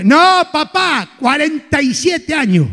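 slow, proud "cuarenta y siete años"; leisurely bragging of "cuarenta y siete años"; slow boast. Acted. Vain man repeating his age.

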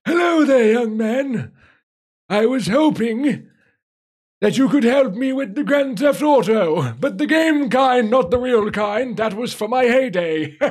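put-on comic old codger voice; old guy voice. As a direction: play a chuckling old man asking for help. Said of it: "I can do a better old guy accent".

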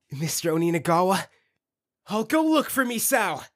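ordinary delivery

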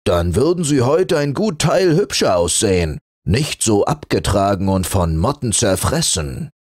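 a fur teasing the flannel; mocking. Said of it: "spöttisch".

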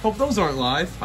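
ordinary talk

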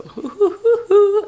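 giddy "hoo-hoo-hoo", high and loud; gleeful high-pitched whoop in short bursts; excited high hooting. Real, not acted. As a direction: hoot with glee, way up high. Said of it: "I'm so excited".

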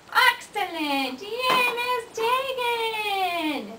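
high, loud, laughing cheer. Real, not acted. Cheering the child's success.

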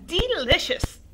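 high, loud, drawn-out crone voice; witchy grandma. Acted. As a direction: relish the word like a hag. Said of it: "she said again".

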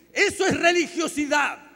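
sudden high preacher's shout; loud, high-pitched denunciation; shouted verdict. Real, not acted. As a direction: explode on the conclusion. Preacher shouts verdict after list.

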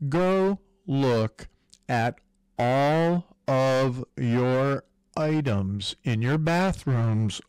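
ordinary talk

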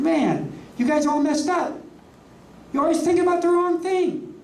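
high, exasperated scolding; hammed-up irritated rebuke; mock exasperated Jesus. Voicing Jesus scolding the arguers.

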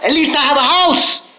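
grateful excitement. Acted. Speaker acting out gratitude for his house, excited. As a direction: burst out counting the blessing. Grateful morning self-talk acted out.

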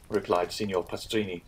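ordinary delivery

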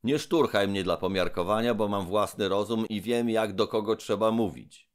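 high, loud, indignant demand; angry Trull. Acted. Trull demands release from the robber, loudly. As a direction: order him off, outraged. Trull rebuking his friend.

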